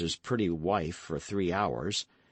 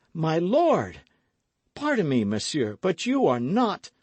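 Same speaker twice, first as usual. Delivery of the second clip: stunned realization; 7 semitones higher, 4 dB louder than usual. A narrator's reading. Realizing he faces a duke.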